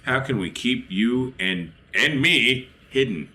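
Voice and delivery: normal talking voice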